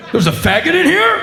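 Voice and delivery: high-pitched incredulous question; hammed-up panic at a supposed intruder; mock alarmed bystander. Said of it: "three men around me are like".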